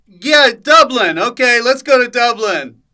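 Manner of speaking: loud, high rallying announcement; acted director's call. Director choosing today's location, mock rallying call. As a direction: call it out to the whole crew.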